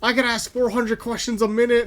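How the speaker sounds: mock indignant yell; mock yelling cashier. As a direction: imitate an irate coworker without raising the volume. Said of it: "yelling off 'cause she's like".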